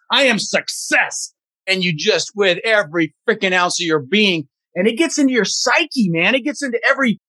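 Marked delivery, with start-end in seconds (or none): 0.1-1.3 "success" punched on its last syllable; slow affirmation landing hard on "success"; stress on SUCCESS. Shown as a demonstration. Coach demonstrating an affirmation, stress on "success". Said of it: "you emphasize different words".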